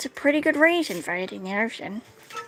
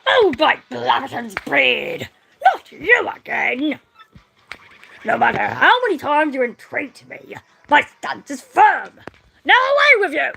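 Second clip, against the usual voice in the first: put-on grumpy old sailor; 4 semitones higher, 7 dB louder than usual. Old sailor Bobbery loudly refusing his unwanted visitors. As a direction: roar him away like a stubborn old salt.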